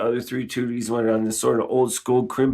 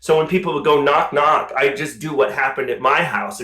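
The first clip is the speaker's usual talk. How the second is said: ordinary delivery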